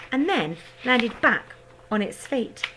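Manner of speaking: usual speaking voice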